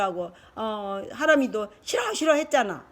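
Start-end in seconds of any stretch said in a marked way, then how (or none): 1.8-2.3 put-on high, insistent child's no; mimicked refusal. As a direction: imitate a kid refusing outright. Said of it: "왜 싫어란 말을 자주 써?". (아이가 떼쓰듯 높게 싫다고 흉내)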